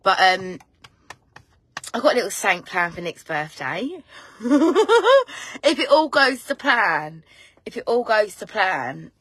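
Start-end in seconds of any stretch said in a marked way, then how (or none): 4.1-5.6 high, rising cackle of glee; gleeful laugh. Real, not acted. Gloating over a secret birthday plan, rising gleeful laugh. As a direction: laugh louder and higher as it goes.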